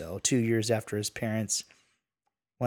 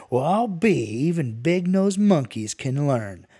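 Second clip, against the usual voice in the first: loud, raised-pitch jeer; mocking amazement; 5 semitones higher, 6 dB louder than usual. A narrator's reading. Dingo jeers at the kid before his posse, mocking. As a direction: crow it mockingly, drawing out the words.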